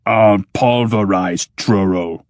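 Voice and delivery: slow, heavy machine threat; menacing machine. Acted. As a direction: grind out the threat like iron.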